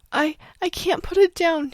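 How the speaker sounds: put-on high, choked child's voice; frightened child choking up; choked crying. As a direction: force the words out through tears. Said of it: "she choked".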